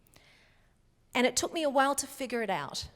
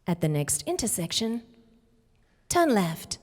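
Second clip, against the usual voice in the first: measured, evenly spaced satnav prompt; GPS navigation voice; 3 semitones lower than usual. Shown as a demonstration. Performing an audition line for the audience, GPS voice. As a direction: give driving directions like a recorded guide. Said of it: "they had me say some things like".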